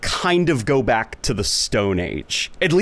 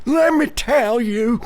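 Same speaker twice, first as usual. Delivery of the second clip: higher, slow, hammed-up old man; 11 semitones higher, slower than usual. Impression drifting into an old man, slow and hammed-up. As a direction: croak it out like a grandpa. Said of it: "becoming like an old man".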